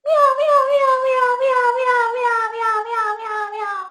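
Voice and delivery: cat meows dying down; front-of-mouth meow. Shown as a demonstration. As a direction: meow fading away. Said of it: "still making that sound with the front of my mouth".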